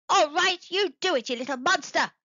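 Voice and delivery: high, loud angry yell; angry shout. A narrator's reading. Squeaky shouts at the rude apple fiend, angrily. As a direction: snap at the fiend furiously. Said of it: "he shouted".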